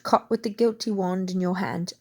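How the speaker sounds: plain reading voice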